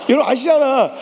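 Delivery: ordinary delivery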